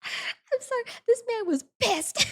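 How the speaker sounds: high giggly disbelief; laughing. Real, not acted. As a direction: squeak it out through laughter.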